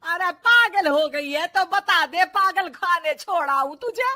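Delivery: angry saas telling her off; furious mother-in-law. Acted. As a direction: lay into the daughter-in-law at full volume. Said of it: "सास का पारा फिर सातवें आसमान में".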